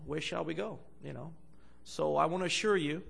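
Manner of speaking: usual speaking voice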